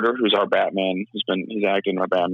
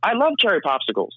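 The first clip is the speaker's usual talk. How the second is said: very high, bright cheery lilt; Spider-Man voice; 11 semitones higher than usual. Shown as a demonstration. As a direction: keep it way up like an upbeat hero. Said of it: "I'll give you an example here".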